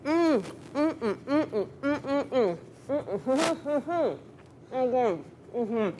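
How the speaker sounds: humming through a mouthful of sandwich; cheeks stuffed full. Real, not acted. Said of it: "People get mad if I talk with my mouth full".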